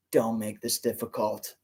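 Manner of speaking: low, smooth menacing voice; smooth villain voice. A narrator's reading. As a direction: purr it like a calm villain. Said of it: "the Bergen smoothly said".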